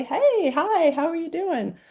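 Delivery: high, sing-song hello to a passerby; bright cheery greeting. Retold or imitated. Smiling walker greets the speaker on a walk, high and cheery. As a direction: greet them like a beaming stranger.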